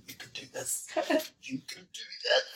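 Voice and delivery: hushed, mock self-encouragement; secret pep talk. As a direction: whisper it to yourself as if hiding, hammed up. Imagined pep talk behind whiteboard.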